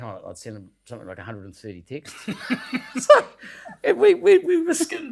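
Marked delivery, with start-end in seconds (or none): 2.2-2.9 airy giggle bursting out; laughing at himself; high breathless laugh. Real, not acted. Laughing at own mishap.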